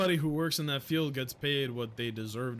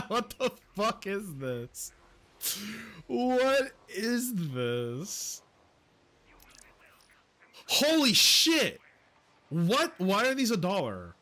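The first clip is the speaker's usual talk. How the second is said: shocked disbelief; 6 semitones higher than usual. Real, not acted. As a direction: stretch it out, then explode. Shocked at absurd shop items.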